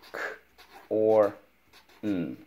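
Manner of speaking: K, or, N as isolated sounds with long gaps; sounding out. Shown as a demonstration. Teacher repeats corn's sounds for pupil, sounding out. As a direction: pause after each sound in K, or, N. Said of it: "Let's go".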